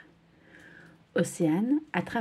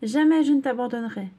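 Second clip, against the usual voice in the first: higher, louder vow; determined shout; 5 semitones higher, 5 dB louder than usual. Acted. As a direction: shout the promise with resolve. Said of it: "crie Océane". (serment crié, plus fort)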